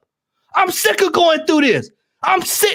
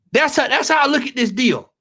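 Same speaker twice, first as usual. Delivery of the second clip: usual speaking voice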